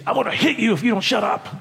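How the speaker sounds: mock threat. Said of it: "he said".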